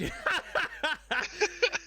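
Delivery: high giggling burst of laughter; giggly laugh. Real, not acted. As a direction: break into a high tipsy giggle. Giggling over chat comment.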